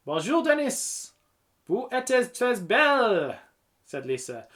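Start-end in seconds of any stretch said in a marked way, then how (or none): 0.1-3.4 high, gushing mock French; playful high-pitched French accent. A narrator's reading. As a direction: pay a compliment in hammed-up French. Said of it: "anything in a French accent".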